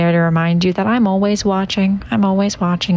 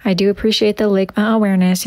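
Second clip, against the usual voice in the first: ordinary talk, nothing marked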